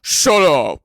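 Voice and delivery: loud mock outburst; retold shouted retort. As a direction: yell back at a teasing friend. Acted retort to friend's crude question.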